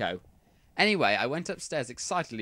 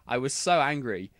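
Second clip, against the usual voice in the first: ordinary delivery, nothing marked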